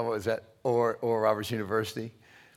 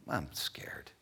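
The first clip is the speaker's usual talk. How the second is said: scared whisper; 8 semitones lower, 9 dB quieter than usual. Retold or imitated. Retelling fear before witnessing.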